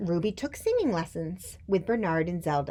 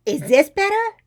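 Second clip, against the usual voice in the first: high, blaring question; loud child; 11 semitones higher, 13 dB louder than usual. A narrator's reading. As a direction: ask it far too loudly. Loud Ruby asks teacher.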